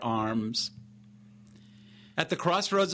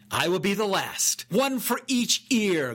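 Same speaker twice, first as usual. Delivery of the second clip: mock-hearty boast, pitched up and drawn out; guffawing; 5 semitones higher than usual. A narrator's reading. Sergeant topping his men's taunts, a touch of guffaw. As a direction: crow it with relish, without real laughter. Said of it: "guffawed Sergeant Aquatus".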